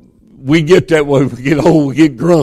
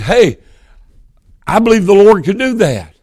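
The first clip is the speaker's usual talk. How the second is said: normal talking voice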